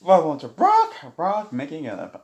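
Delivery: loud, slow, high announcer voice; grand intro to his channel; show intro. Acted. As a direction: boom it out like a show host.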